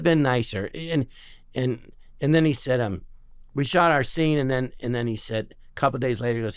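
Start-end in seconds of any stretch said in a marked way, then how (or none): none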